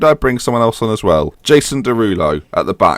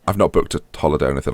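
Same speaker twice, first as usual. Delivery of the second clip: ordinary talk, nothing marked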